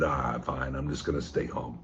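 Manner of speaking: low, soft, resigned mumble of a quitter; defeated giving up. Retold or imitated. Imitating a lesser person who gives up, low and soft. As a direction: sag into a weary shrug and give in. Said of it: "a lesser person would have said".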